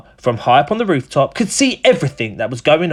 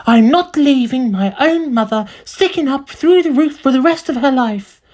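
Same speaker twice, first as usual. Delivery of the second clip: high-pitched protesting woman; indignant mother; 9 semitones higher than usual. A narrator's reading. Missus Cranky refusing to leave mother in the roof, indignant. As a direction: insist in a high, put-on voice.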